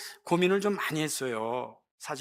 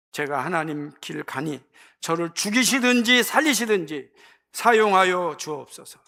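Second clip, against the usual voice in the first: louder, pleading prayer; earnest prayer; 7 dB louder than usual. Shown as a demonstration. Preacher modelling a prayer, loud and earnest. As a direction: pray it out with full conviction. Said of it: "이렇게 기도해야 돼요".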